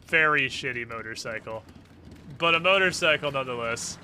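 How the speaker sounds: usual speaking voice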